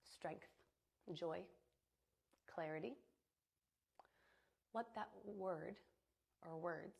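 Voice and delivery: calm, even meditation voice with long pauses; soothing visualization, phrase by phrase; guided meditation. Acted. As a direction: guide them gently into the imagery, unhurried. Leads breath and visualization.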